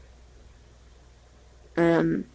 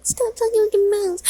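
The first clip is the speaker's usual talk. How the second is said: squeaky high mock-mom voice, pitched way up. Imitating mom nagging about talking, in a squeaky high mock voice. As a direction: parody her nagging as a shrill cartoon. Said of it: "'Cause mom's always like".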